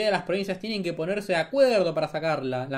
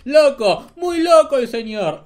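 high, loud mock old lady voice; imitating neighbor; 7 semitones higher, 9 dB louder, slower than usual. Streamer mocks Milei's ex-neighbor, high and loud. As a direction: ham up the neighbor's horror. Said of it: "lo tildó de desequilibrado mental".

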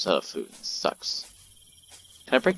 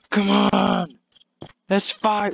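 fight call; 11 semitones higher, 4 dB louder, much slower than usual. Pretend. Calling out the monster.

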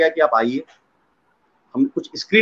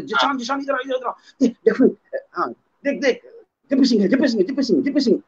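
excited calling; 6 semitones higher, 4 dB quieter than usual. Retold or imitated. Director excitedly calls the writer.

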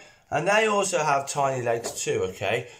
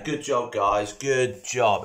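ordinary delivery

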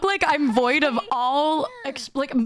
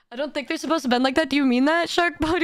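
ordinary talk, nothing marked

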